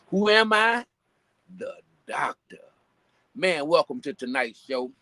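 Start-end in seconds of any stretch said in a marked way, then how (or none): 0.1-2.6 announcer call. Acted. Call and response intro.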